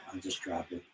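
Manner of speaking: breathy whisper to himself; whispered under the breath; whispered aside. Real, not acted. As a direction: drop to a whisper, giving up. Muttered aside while struggling.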